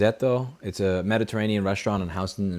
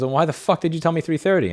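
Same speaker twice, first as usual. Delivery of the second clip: higher-pitched mock indignation; retold annoyance; 8 semitones higher than usual. Date confronts him over the time, retold annoyance. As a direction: demand an explanation, pitched up. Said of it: "she was actually pissed".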